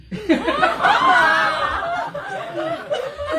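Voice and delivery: high, loud burst of laughter; big real laugh. Real, not acted. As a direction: let out a long delighted laugh.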